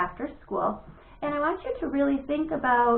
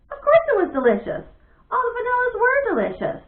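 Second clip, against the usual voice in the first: indignant; 12 semitones higher, 6 dB louder than usual. A narrator's reading. Narration mocking Bat's thoughts.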